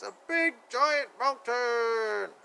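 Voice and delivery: slow, drawn-out awe; awed slow. Acted. Reader showing the giant mountain page, slow awe. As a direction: stretch it out like it's enormous.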